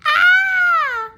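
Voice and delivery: put-on very high, loud girlish scream; frightened girl screaming. A narrator's reading. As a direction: shriek in terror. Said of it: "screamed loudly".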